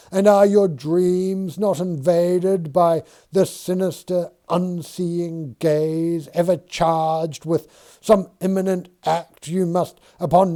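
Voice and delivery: spook him with the image; uneasy Dixon. Acted.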